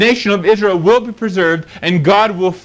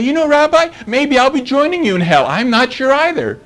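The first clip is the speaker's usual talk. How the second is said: higher, hesitant pretend voice; preacher mimicking a works-based believer talking to the rabbi, higher and unsure; mock unsure believer; 6 semitones higher than usual. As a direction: play the doubter who isn't sure he's saved. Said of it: "I would say".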